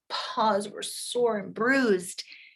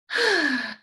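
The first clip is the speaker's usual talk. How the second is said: long falling sigh; exasperated sigh; 6 semitones higher, 3 dB louder than usual. A narrator's reading. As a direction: let out a high, weary exhale. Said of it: "She sighed again".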